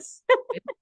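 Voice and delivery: sudden high giggle; bright cackle breaking out; high burst of laughter. Real, not acted. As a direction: laugh at her own joke. Laughs after baseball bat joke.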